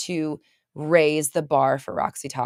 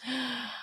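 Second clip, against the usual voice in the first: gasp; 7 semitones higher, 11 dB quieter than usual. Real, not acted. Reacts to viewer comment.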